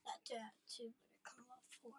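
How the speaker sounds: unvoiced, very soft whisper. Real, not acted. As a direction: whisper it quietly aside. Aside to parent.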